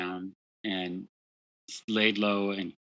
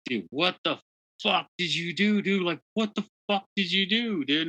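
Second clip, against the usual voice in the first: much higher, incredulous angry yell; furious boss retold; 10 semitones higher than usual. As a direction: hurl the question at him in disbelief. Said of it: "And he was fucking pissed".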